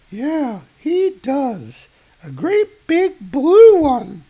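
high, bright agent voice; high female voice. Acted. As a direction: raise the pitch for the woman agent. Agent answers reporter.

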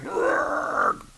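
rough, drawn-out comic-book growl; strained growl. Acted. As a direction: growl like a struggling hero. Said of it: "Thor's going".

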